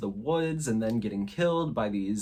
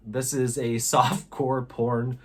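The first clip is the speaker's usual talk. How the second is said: normal talking voice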